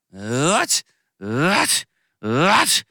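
loud acted sneezes, rising in pitch; three sneezes. A narrator's reading. Moved showman sneezes three times, explosively loud. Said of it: "他又打了三个喷嚏".

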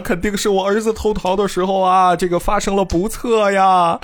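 high wailing lament; hammed-up grieving father; father wailing over his son's head; crying. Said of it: "就开始哭了".

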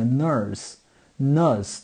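nurse said twice in an English vowel. Shown as a demonstration. Showing fans the Dutch U sound, English demo. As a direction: model the vowel of nurse carefully. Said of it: "趋近于英语这个nurse".

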